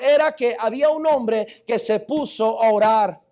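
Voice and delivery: loud pulpit reading of scripture; forceful sermon delivery; preaching. Acted. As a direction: proclaim Paul's words to the church.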